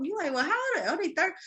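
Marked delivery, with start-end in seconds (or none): 0.3-0.9 high mock disbelief; incredulous question. Friend asking in disbelief about someone's age. 0.9-1.4 high, put-on gossipy voice; mock gossip reply. Gossiping neighbor reports a young person's illness, mock voice.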